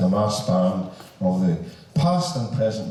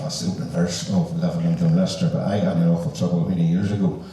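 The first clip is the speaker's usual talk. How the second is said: normal talking voice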